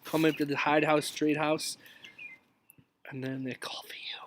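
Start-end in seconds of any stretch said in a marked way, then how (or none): 3.1-4.2 whispered dread, much softer; despairing hush. Acted. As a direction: whisper it like bad news. Dreaded kids calling.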